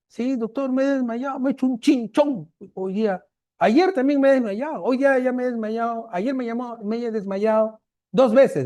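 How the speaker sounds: a hint of a timid girl listing her faints; patient voice.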